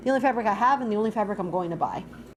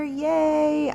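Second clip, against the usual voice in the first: excited; 6 semitones higher, 6 dB louder than usual. Real, not acted. Shows finished flower.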